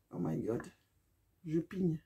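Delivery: under-the-breath oh my God; whispered dread. Real, not acted. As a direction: breathe it out, bracing yourself. Bracing before piercing ear.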